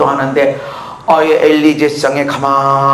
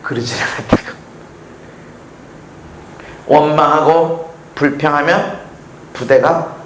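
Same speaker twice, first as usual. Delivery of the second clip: ordinary talk, nothing marked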